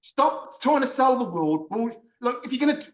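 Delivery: high-pitched, fed-up outburst; exasperated rant. Real, not acted. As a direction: vent it, voice pushed up high. Ranting, finished with the director.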